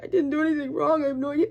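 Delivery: a touch of Job crying out to the Lord; Job pleading. Retold or imitated. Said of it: "and he's like".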